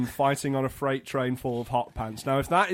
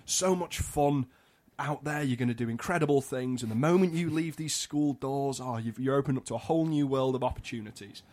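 soft, lower inspirational speech; imitated form tutors; 3 semitones lower, 6 dB quieter than usual. Form tutors sending school leavers into the world, soft mock-inspiring voice. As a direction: softly gush an imitated send-off. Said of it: "All the form tutors went up like".

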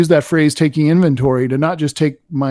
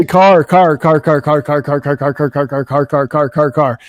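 repeating a word over and over. Shown as a demonstration. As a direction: chant the word car until it empties out. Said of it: "if I say it fifty thousand more times".

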